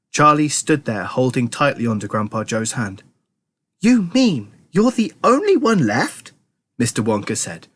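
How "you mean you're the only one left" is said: high, slow show of surprise; put-on amazement, stretched out and high; feigned surprise. A narrator's reading. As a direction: draw it out in pretend astonishment. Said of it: "pretending to be surprised".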